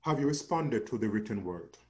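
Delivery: usual speaking voice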